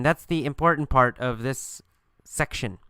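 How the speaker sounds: ordinary talk, nothing marked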